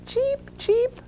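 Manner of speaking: very high, tiny duckling chirps; duckling cheeps. A narrator's reading. Ducklings chime in after Mother Duck, high cheeping. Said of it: "said the ducklings".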